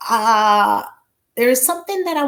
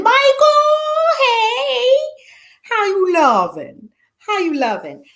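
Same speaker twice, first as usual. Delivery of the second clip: excited greeting; 12 semitones higher than usual. Real, not acted. Host greeting a viewer in chat, high excited hello. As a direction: call out to a friend.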